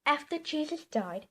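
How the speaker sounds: ordinary delivery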